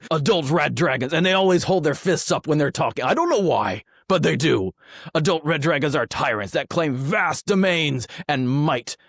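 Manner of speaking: rough orcish reading voice. Acted. Host reading the Red Dragon entry aloud, orc voice. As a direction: read it out as an orc. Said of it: "This is my orc voice".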